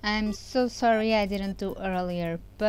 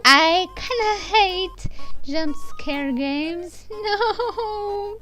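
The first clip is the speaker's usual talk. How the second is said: high, drawn-out whimper with a laugh; hammed-up tearful protest, half laughing; mock dread; 9 semitones higher, 5 dB louder, much slower than usual. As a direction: whine slowly and high in playful dread. Mock dread of jump scare games.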